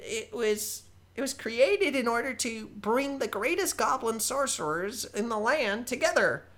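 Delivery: high, loud and chatty goblin voice; young goblin sorcerer introducing himself; eager young goblin. Acted. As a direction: ramble on like a nervous young goblin. Goblin Balthazar voiced throughout.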